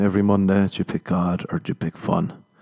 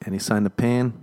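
ordinary talk, nothing marked

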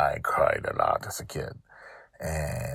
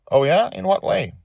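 higher, brighter amused question; lightly voiced curious father; 14 semitones higher, 7 dB louder than usual. Dragon Father asking his daughter, lightly amused. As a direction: humour the little one.

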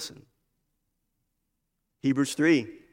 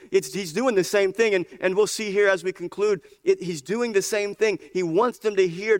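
higher, insistent, driving preacher voice; impassioned preaching; 8 semitones higher, 6 dB louder than usual. Real, not acted. Preacher asking whether we have ears to hear, impassioned. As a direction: press the challenge home with urgency.

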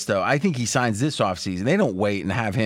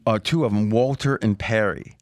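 ordinary delivery

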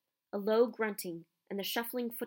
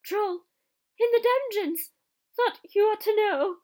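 very high, loud panicked cry; panicked shriek; terrified gasp; 13 semitones higher, 11 dB louder than usual. A narrator's reading. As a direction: burst in terrified with the news. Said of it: "and gasped".

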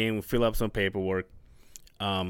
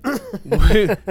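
loud real laugh; burst of laughter; 11 semitones higher, 12 dB louder than usual. Real, not acted. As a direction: laugh out loud mid-story. Laughing while telling story.

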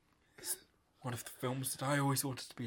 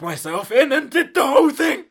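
high, very loud declaration; mounting outburst; 11 semitones higher, 18 dB louder than usual. Acted. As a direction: build into a full-voiced shout. Boasting climax of absurd stage story.

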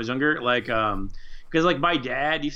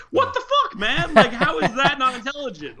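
much higher, laughing amazed outburst; laughing disbelief; 11 semitones higher, 5 dB louder than usual. Retold or imitated. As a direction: blurt it in laughing shock. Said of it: "I was like".

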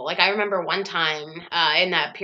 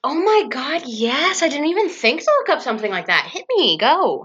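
excited; 8 semitones higher, 4 dB louder than usual. Real, not acted. Eager to hear celebrity list.

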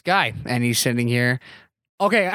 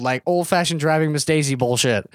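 ordinary delivery

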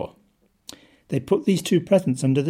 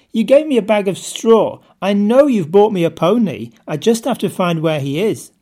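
loud and thrilled, higher voice; excited; 5 semitones higher, 7 dB louder than usual. Acted. Optimist twin tells his parents about the pony, loud eager delight. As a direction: burst with hope like a delighted child.

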